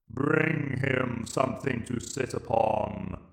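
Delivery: slow, cold alien command; Dalek Emperor order. Acted. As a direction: order it like a machine ruler, slow. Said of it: "ordered the Emperor".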